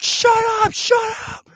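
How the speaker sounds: very high screamed command, repeated; shrieking imitation of a frantic girl; screaming. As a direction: scream at a barking dog. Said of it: "she starts screaming at the dog".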